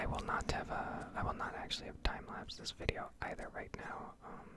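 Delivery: normal talking voice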